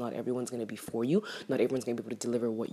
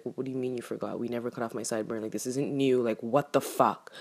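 ordinary talk

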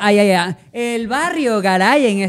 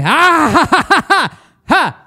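loud, high hammed-up cackle; exaggerated laugh; 7 semitones higher, 9 dB louder, much slower than usual. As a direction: read the laugh out big and fake. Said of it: "se ríe con ganas". (carcajada exagerada y fingida, aguda y fuerte)

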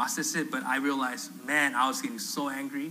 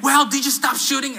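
high, louder mock interrogation; retold confrontation, pitched up; indignant challenge; 9 semitones higher, 6 dB louder than usual. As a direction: imitate the accusing lady. Lady confronts him again, acted.

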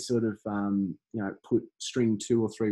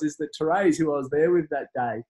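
normal talking voice

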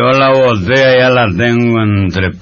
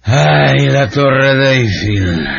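put-on drunken dreamy reverie; 6 semitones lower, 4 dB quieter than usual. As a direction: sigh it out, lost in memories.

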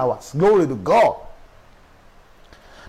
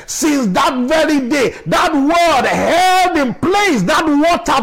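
full-force preaching; 6 semitones higher than usual. Acted. Pastor urging the church to believe, preaching at full force. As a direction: preach it out to the whole congregation.

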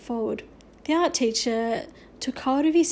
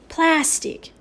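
loud, higher, scornful one-word correction; 5 semitones higher, 5 dB louder than usual. A narrator's reading. Little Bear correcting Boone about the figures, scornful. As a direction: throw the word out with contempt. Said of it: "said Little Bear scornfully".

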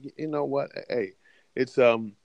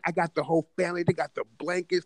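usual speaking voice

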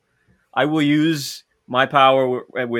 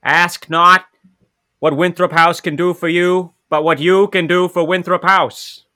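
loud, raised oratorical Kennedy voice; JFK impression; 6 semitones higher, 8 dB louder, slower than usual. Student proving he is a Kennedy, declaiming in the voice. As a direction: declaim it like a presidential address. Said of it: "I can do the voice".

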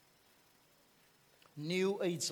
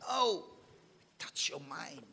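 ordinary delivery

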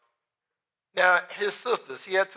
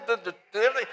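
ordinary delivery

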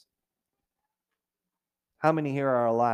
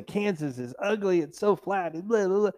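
a touch higher, lightly dismissive; mild mocking complainer; mock critic; 6 semitones higher than usual. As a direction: sneer lightly at Kansas. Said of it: "people say".

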